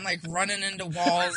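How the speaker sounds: usual speaking voice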